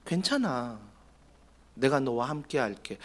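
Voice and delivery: soft, hushed "괜찮아"; tender reassurance. Acted. Parent holding a scared child, whispering comfort softly. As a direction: comfort them as if in a hug.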